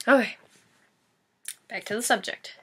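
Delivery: usual speaking voice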